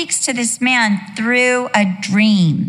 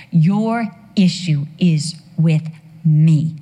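slow stern God; 4 semitones lower, slower than usual. Acted. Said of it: "God says".

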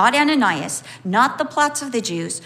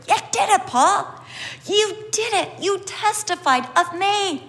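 higher, gentle praising voice; Jesus praising Paul; 8 semitones higher than usual. Retold or imitated. Preacher voicing Jesus praising Paul, higher and warm. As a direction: voice Jesus proud of Paul, lifted up high. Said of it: "Jesus is like".

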